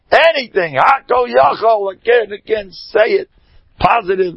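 rousing exhortation. Real, not acted. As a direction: fire them up to repeat it. Rousing the audience to faith.

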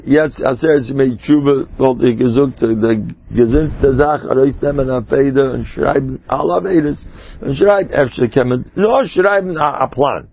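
ordinary talk, nothing marked

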